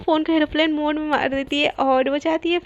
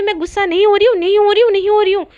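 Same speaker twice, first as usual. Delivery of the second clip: loud, high, repeated angry denial; heated, defensive protest; flaring-up denial; 5 semitones higher, 8 dB louder, faster than usual. Acted. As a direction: insist harder with each repeat. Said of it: "इतना भड़क क्यों रही हो".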